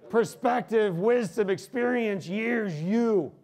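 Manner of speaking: each word shouted high and loud; rousing shout. Real, not acted. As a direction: hammer every word home to the crowd. Preacher shouting a climactic list.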